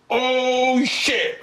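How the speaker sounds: high, drawn-out mock "oh shit"; shocked reaction. Boyfriend learning she is called pregnant, shocked. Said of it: "the guy went like this".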